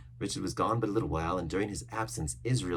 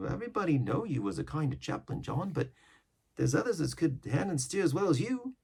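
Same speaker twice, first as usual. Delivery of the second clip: higher sailor's voice; sailor arguing; 8 semitones higher than usual. Acted. Coxswain answering the cook, higher sailor voice. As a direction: push back on the cook.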